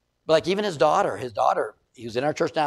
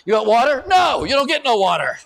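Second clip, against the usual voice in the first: raised, high mock scolding; scolding the dog; 7 semitones higher, 6 dB louder than usual. Owner scolding the dog on a walk, loud and high. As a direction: snap at the dog like a fed-up owner.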